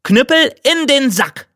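high, ringing recall command; calling out. A narrator's reading. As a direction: call the cudgel back with a clear order. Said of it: "Dann rief er". (hoch ausgerufener Befehl)

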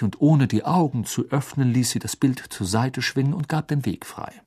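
ordinary delivery

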